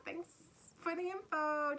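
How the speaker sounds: high, bright mock thanks; hammed-up fool grabbing info; giddy fool. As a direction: chirp it like a cheeky fool running off. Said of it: "the fool".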